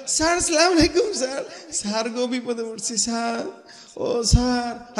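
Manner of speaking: high-pitched, servile pleading to a bigwig; fawning greeting. Acted. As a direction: grovel before an important man, dragging the last words. Fawning greeting to big thief. (তোষামোদি মিনতির সুর)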